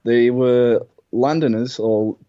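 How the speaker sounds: rough attempt at an Australian accent through gritted teeth. Shown as a demonstration. Brit explaining Australians' London origins, imperfect Australian accent. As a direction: grit your teeth and try Australian by way of Cockney.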